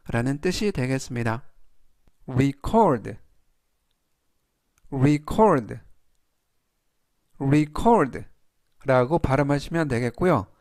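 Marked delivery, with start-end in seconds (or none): 2.3-3.2 "record" as re-CORD, weight on the O; stress on the O. Shown as a demonstration. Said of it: "이때 강세는 알파벳 O의 강세가". 4.9-5.7 "record" as re-CORD, weight on the O; stress on the O. Shown as a demonstration. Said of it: "이때 강세는 알파벳 O의 강세가". 7.4-8.2 "record" as re-CORD, weight on the O; stress on the O. Shown as a demonstration. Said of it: "이때 강세는 알파벳 O의 강세가".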